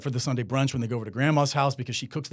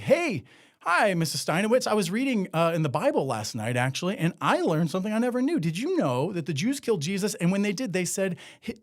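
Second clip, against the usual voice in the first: higher-pitched, eager mock student; chatty classroom question, pitched up; student asking teacher; 5 semitones higher than usual. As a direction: play a kid innocently asking the teacher. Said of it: "asking it as a question in class".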